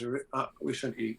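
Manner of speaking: chewing while talking; mouth full. Real, not acted. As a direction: talk through a mouthful. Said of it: "Do you hear me chewing?".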